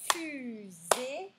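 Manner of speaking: "Fusée" stretched into two long syllables; syllable split. Shown as a demonstration. Teacher counting syllables for pupils, "Fusée" in two beats. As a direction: hold each half of "Fusée", buzzing the z. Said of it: "deux syllabes".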